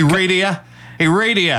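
radio said as "ray-dee-uh" like the cop; local radio pronunciation. Retold or imitated. Mimicking the cop's "ray-dee-uh" on the police show. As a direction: imitate the cop saying radio. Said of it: "That's how they say radio there".

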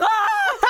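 high, loud comic scream; mock panicked scream. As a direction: scream in hammed-up panic at Bigfoot. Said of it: "And he's like".

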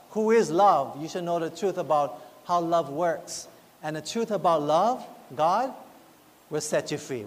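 ordinary talk, nothing marked

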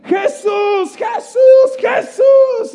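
screaming high and loud; desperate shout. Retold or imitated. Desperate beggar screaming for Jesus over the crowd. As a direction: cry out with everything you have. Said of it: "empieza a gritar más".

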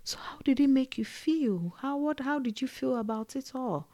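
soft, breathy asking how you feel; hushed question. Retold or imitated. Elder asking the narrator how he felt, soft and hushed. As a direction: hush it like a counsellor prying gently. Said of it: "someone asking how you feel".

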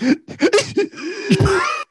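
very high, hammed-up crying laugh; mock laugh. Shown as a demonstration. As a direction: show off an absurd screeching laugh. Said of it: "what if that's how I laugh?".

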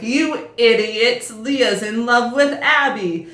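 slow, loud exasperated shout at the screen; mock exasperated yell. Viewer yelling at the movie characters, exasperated.

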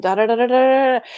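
mock blah-blah, building; rattled-off "da da da". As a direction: rattle on like an endless worry list. Spelt noise for a worry list.

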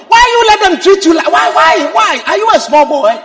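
high, loud, repeated demands; indignant nagging. Acted. A wife asking her husband why he allows such treatment, indignant. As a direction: badger him with exasperated questions.

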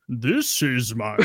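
slow, drawn-out cartoonish accent; mock accent attempt. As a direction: parody a bad all-purpose accent. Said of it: "no matter what accent you pick".

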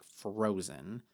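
normal talking voice

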